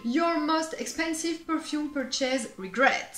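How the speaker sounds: put-on slow, high French-accented English. Reading the question to viewers in French-accented English. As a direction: deliver it in mock-grand French-accented English. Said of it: "je vais vous le dire en anglais".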